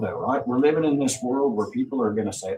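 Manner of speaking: normal talking voice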